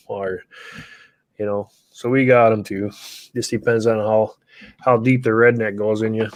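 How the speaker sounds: usual speaking voice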